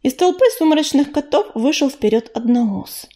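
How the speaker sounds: ordinary delivery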